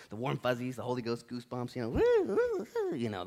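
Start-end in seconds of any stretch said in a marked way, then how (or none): 1.9-3.2 high, loud mock woo cries. Preacher imitating Holy Ghost goosebumps to congregation, playful woo.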